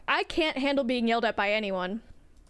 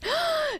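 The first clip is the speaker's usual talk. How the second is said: high startled intake of breath; sharp gasp. Real, not acted. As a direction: gasp in surprise. Gasp when anomaly vanishes.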